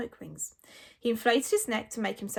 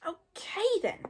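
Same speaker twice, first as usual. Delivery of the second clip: high, slow little dragon; squeaky, drawn-out agreement; Toothless; 10 semitones higher, slower than usual. A narrator's reading. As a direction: drawl it in a small, high creature voice. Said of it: "said Toothless".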